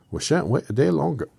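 high, loud Irish Scarecrow; Irish accent. Acted. Scarecrow refusing to wait, in a high Irish accent. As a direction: slip into a loud, lilting Irish protest. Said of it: "he becomes Irish every time".

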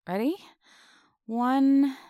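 usual speaking voice

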